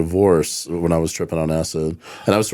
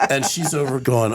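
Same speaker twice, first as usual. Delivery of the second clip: ordinary talk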